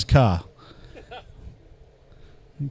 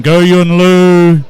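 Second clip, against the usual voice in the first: long drawn-out cheer, louder; 2 semitones higher, 6 dB louder, much slower than usual. Real, not acted. Announcer hyping a drifter to the crowd with a drawn-out cheer. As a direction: stretch the name out slowly like a cheer.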